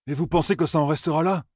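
high-pitched, pointed challenge; Perrine, challenging. Acted.

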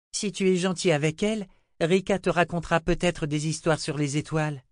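put-on high-pitched little girl voice; little girl Rachel. Little girl urges the old wizard to eat, high child voice.

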